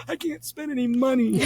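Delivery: high, loud panicked wail; high-pitched hammed-up dismay; mock panic. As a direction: exaggerate the horror of being broke. Retold panic over empty account.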